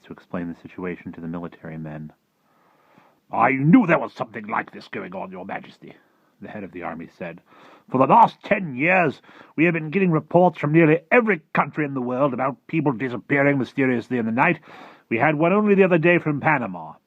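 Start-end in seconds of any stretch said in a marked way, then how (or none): 3.3-5.9 lightly voiced army chief, starting loud. Army chief addressing the Queen. 7.9-16.9 loud, high-pitched military report; blustering army chief. Acted. Voices head of the army.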